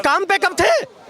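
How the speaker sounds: "काम पे कब थे" drawn out slowly and pitched high; emphatic challenge. Real, not acted. Man retorting to interviewer about leave, "काम पे कब थे" as a drawn-out challenge.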